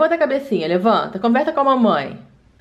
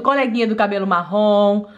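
ordinary talk, nothing marked

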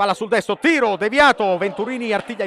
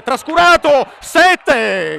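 excited call; 6 semitones higher, 9 dB louder, much slower than usual. Real, not acted. Excited call of near chance.

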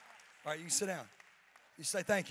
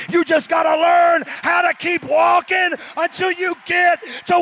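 loud, higher, slowing shout; preaching at full force; 9 semitones higher, 23 dB louder, slower than usual. Real, not acted. Pastor crowning the sermon with a slow shout. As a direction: hammer the ending, stretching each word.

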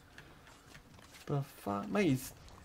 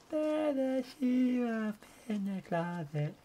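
high, slow spooky singsong; 11 semitones higher, 4 dB quieter, much slower than usual. Pretend. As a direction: draw it out like a ghost story. Repeats line in eerie voice.

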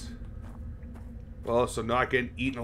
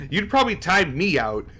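laughing; 6 semitones higher than usual. Real, not acted. Joking with chat, laughing.

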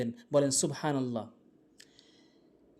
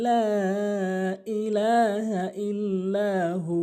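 slow, drawn-out chanted recitation; Quran recitation; 2 semitones higher, much slower than usual. Shown as a demonstration. Teacher reciting the verse slowly for students.

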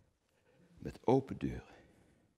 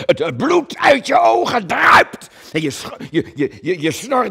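furious shout; 13 semitones higher, 12 dB louder than usual. Acted. Actor describing Coen's fury, shouting it out. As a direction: let the blood-rage burst out.

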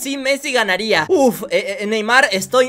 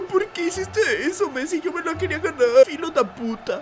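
mock tearful whimper, much softer; Neymar crying; 2 semitones higher, 6 dB quieter than usual. Defeated player blames his rival, mock crying. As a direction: play a sore loser sobbing softly. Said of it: "¿Neymar, estás llorando?".

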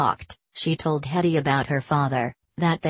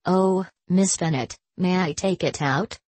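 usual speaking voice

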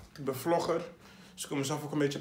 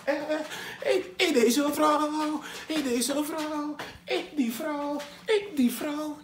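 higher, slowed, excited mock voice over a woman; mock lovestruck guy; 9 semitones higher, slower than usual. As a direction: ham up a desperate admirer. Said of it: "op zoek ben naar liefde van".

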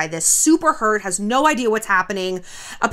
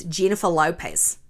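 Australian accent trigger phrase; softly trying out an Australian accent; 5 semitones lower, 4 dB quieter than usual. Shown as a demonstration. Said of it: "She said for Australian".

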